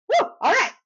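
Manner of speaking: high, loud little victory whoop; mock triumph. Son thinking he has finished changing, triumphant. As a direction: celebrate a job done, pitch way up.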